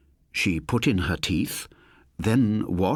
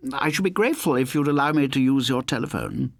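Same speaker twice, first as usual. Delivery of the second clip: formal lodger voice; 7 semitones higher than usual. Acted. Saunders asks to use phone.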